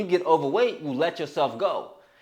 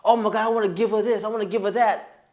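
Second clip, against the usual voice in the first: higher, gushing imitation; hammed-up eagerness, pitched up; mock eager giver; 5 semitones higher than usual. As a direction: gush like an over-eager giver. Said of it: "you're the giver".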